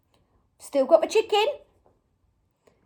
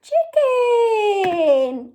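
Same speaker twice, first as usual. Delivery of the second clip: higher, louder, long-stretched word; drawn-out reveal; 5 semitones higher, 3 dB louder than usual. Pretend. Children's presenter revealing the hidden animal, drawn-out cheer. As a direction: sing out the answer, stretching it.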